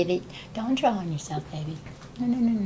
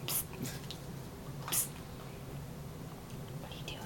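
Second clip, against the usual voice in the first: hushed whisper; 22 dB quieter, much faster than usual. Real, not acted. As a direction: whisper it softly. Whispering to child.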